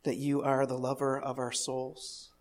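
soft, low prayer to God. Real, not acted. Pastor thanking God before the sermon, soft prayer. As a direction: pray quietly and reverently, with unhurried pauses.

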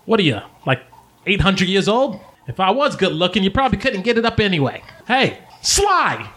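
high, brash gnome woman; female gnome, insulting. Acted. Gnome woman sizing up the stranger, insulting.

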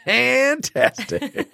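very high, stretched-out FANTASTIC; playful squealed exclamation. Pretend. Repeats it as a joke.

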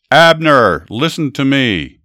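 loud, high call across to a distant camp; yelling. A narrator's reading. David yells from the hill to Abner in the camp, loud call. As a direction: shout it from the hilltop, slow on the last words. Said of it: "David yelled at Saul's camp".

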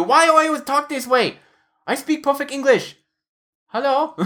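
high, imitated Filipino-accented wife; high-pitched mock Filipino accent. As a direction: protest indignantly in a put-on Filipino accent. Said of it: "a Filipino accent because his wife is".

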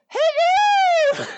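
very high, long-drawn falsetto greeting; hammed-up falsetto lady trill; Missus Doubtfire. Shown as a demonstration. Game master mocking girl voices, exaggerated falsetto. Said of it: "Missus Doubtfire".

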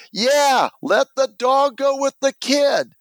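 very high-pitched imitation of protesting onlookers; crowd chiming in. Crowd backing the kid against the ambulance crew, imitated chiming-in protest. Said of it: "everyone starts to chime in".

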